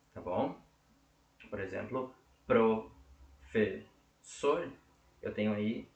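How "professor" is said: "professor" slowly split as pro, fes, sor; syllable by syllable. Shown as a demonstration. Teacher giving an example of three syllables, "professor" split slowly. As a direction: break "professor" into three slow pieces with pauses. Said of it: "três sílabas".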